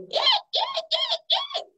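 very high, piercing little eeks; bat squeaks. Retold or imitated. Bats make noise in the house, high squeaks. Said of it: "the bats went".

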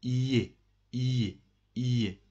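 iye, a glide with no L; the ille sound. Shown as a demonstration. Teacher to class, drilling the ille sound as iye. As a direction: drill iye slowly and clearly. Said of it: "you must pronounce like that".

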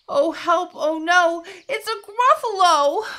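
high, loud storybook panic; alarmed. A narrator's reading. Mouse suddenly meets the Gruffalo, high alarmed cry.

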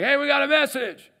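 high loud hail to the walls; calling out. Retold or imitated. Envoy calling up to the city walls, loud high hail. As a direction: call up to the city from afar.

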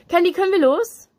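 loud, high-pitched interruption; calling out. A narrator's reading. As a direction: call over brightly to hurry a friend along. Nick calls out, interrupting them.

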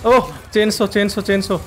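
high-pitched panicky repetition; startled high warning; alarmed cry. Real, not acted. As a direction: call out the danger in a panic. Spots chainsaw enemy.

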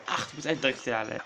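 ordinary talk

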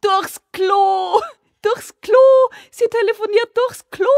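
very high, loud screeching; roaring. A narrator's reading. Father and son leap around the room, screeching roar. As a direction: screech it like wild monkeys. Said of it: "brüllten".

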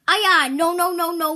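loud high kiai; karate shout. Acted. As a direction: shout as you strike. Kicks practice shout.

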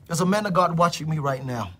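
ordinary delivery

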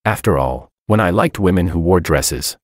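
ordinary delivery, nothing marked

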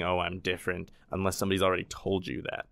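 ordinary talk, nothing marked

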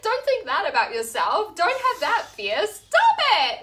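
high, loud scolding STOP IT; mock scolding. Friends scolding each other out of fear, loud and high. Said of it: "we'll just be like".

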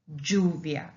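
"lluvia" starting with a j sound; Spanish double L. Shown as a demonstration. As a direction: say "lluvia" as JOO-vya. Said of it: "we pronounce it like".

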